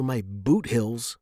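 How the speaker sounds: ordinary delivery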